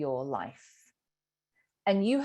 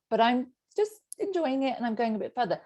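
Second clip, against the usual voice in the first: usual speaking voice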